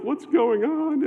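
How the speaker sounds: high, alarmed and a little slow; friends asking in alarm. Retold or imitated. Friends in the car ask him, alarmed and high-pitched. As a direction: mimic the startled friends in the front. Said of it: "And they're like".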